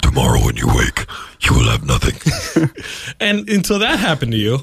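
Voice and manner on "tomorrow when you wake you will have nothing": very deep demonic threat; doom him, menacingly; devil voice. Pretend.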